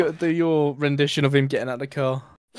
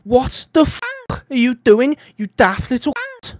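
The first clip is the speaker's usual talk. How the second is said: put-on higher, louder raging Scouse accent; angry Scouse rant; 8 semitones higher, 7 dB louder than usual. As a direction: tear into him like a furious Liverpudlian. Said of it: "Go on, [name]".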